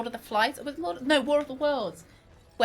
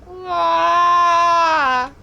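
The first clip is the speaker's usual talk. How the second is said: bee cry; 5 semitones higher, 8 dB louder than usual. Pretend.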